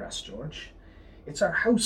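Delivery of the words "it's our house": a higher, louder squeaking mouse voice; squeaky mouse. A narrator's reading. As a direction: squeak it like a worried mother mouse. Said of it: "squeaked the mother mouse".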